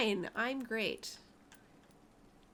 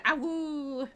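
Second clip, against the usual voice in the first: wolf voice; 3 semitones higher, 3 dB louder than usual. Pretend. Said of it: "That's a sound that vampires make".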